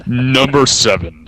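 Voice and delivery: slow booming fighting game announcer. Acted. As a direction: drag out each word like a ring announcer. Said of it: "[name] putting on his new announcer voice".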